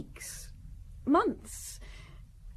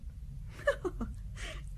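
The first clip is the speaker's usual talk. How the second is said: scornful laugh; 7 semitones higher, 8 dB quieter than usual. Acted. Wife asked about marriage.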